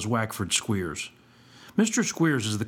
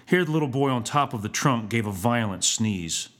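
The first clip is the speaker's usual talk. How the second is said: plain reading voice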